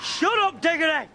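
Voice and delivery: high-pitched yell to shut him up; sharp rebuke. Real, not acted. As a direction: cut off the gloating in a high cry. Cuts off the gloating winner.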